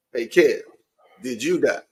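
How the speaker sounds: ordinary talk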